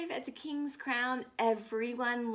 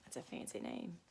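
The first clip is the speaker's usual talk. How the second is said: whispered aside; 10 semitones lower, 12 dB quieter, faster than usual. Real, not acted. Teacher whispering an aside to the class. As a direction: lean in and whisper it.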